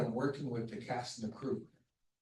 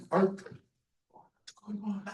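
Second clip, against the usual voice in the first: whispered question; 7 semitones higher, much slower than usual. Retold or imitated. Confused whisper to crying crew.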